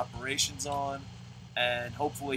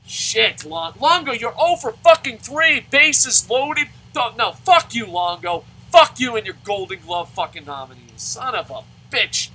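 loud, high furious ranting at the player; angry yelling; 9 semitones higher, 11 dB louder than usual. Real, not acted. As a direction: tear into him for striking out. Raging at player striking out.

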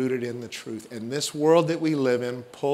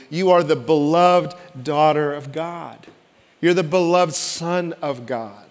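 preached proclamation. Acted. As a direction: proclaim it to the whole room. Proclaimed to congregation.

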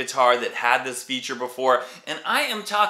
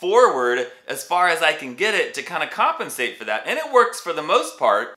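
usual speaking voice

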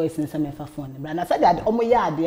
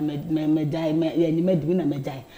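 ordinary delivery

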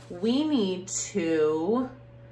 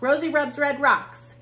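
"Rosie rubs red rocks" rattled off quickly, higher and louder; fast tongue twister; 7 semitones higher, 5 dB louder than usual. Shown as a demonstration. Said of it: "I did it fast".

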